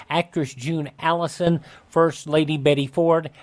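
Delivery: ordinary talk, nothing marked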